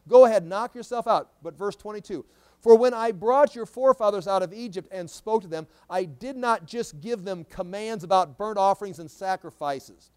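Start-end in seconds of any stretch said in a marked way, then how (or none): 0.1-1.3 loud, raised-pitch mocking go-ahead; sarcastic. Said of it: "This is sarcasm". 2.6-10.1 loud, raised-pitch Bible proclamation; forceful scripture reading. Acted. Reads scripture aloud to congregation.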